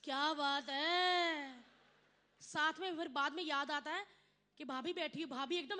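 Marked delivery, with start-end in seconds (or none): 0.0-1.6 slow, louder drawn-out whoop; husband's cheer. Retold or imitated. A husband ogling the dancer lets out a slow loud cheer. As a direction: let out a long appreciative holler. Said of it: "चीख अंदर से निकल आती".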